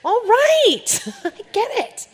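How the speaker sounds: high, loud laughing give-up; exasperated giving in. Retold or imitated. Telling the crowd how she finally gave in to God, high laughing surrender. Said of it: "And I was like".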